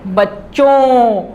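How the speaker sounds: "बच्चों" slowly, ending on a nasal vowel. Shown as a demonstration. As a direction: stretch "बच्चों" and let the end ring nasal. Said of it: "तो इसकी आवाज़ कैसे निकलती है?".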